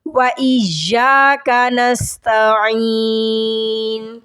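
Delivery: slow chant, pressing the ya of وإياك; recite, leaning on the shadda; stressed recitation. Shown as a demonstration. Teacher reciting with the stressed ya. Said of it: "اس پہ بھی آپ زور دیں گے".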